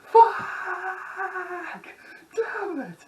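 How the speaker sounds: very high, laughing shriek of a curse; cartoonish high-pitched cussing; mock swearing fit. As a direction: yelp the swear while cracking up. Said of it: "And it's like".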